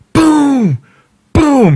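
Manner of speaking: loud, high booming boom, boom; imitating the dentist's hammer strikes, loud booms. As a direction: slam each boom like a mallet. Mimicking hammer blows.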